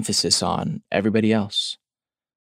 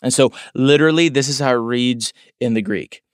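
ordinary talk, nothing marked

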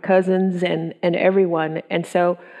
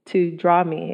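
ordinary talk

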